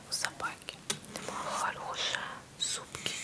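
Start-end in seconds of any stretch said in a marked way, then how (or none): none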